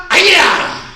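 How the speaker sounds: sharp high martial-arts yell; kiai shout. Shown as a demonstration. Instructor leading class drill, loud kiai shout. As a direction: shout the strike out from the gut.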